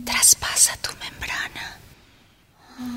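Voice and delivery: slow breathy whisper; right in your ear. Acted. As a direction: whisper it softly and slowly. Repeated line, whispered take.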